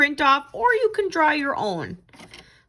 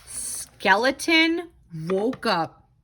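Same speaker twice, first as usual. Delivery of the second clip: ordinary delivery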